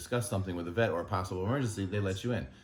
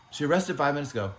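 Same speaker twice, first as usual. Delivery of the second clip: higher and louder, a breezy mock of her delivery; mocking the doctor's voice; 7 semitones higher, 4 dB louder than usual. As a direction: parody the calm, chipper news. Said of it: "this is how she sounded".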